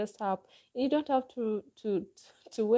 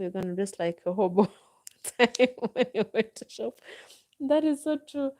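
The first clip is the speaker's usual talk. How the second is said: laughing through the words, getting louder and higher; amused laughing; 4 semitones higher, 4 dB louder than usual. Real, not acted. Streamer amused by a viewer's hobo comment, laughing. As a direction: break into a real laugh, then agree in a raised voice.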